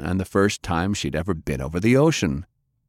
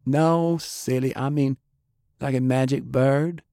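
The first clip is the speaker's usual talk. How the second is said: put-on higher, girlish voice for Faye; a curious kid asking her friend; young girl Faye; 6 semitones higher than usual.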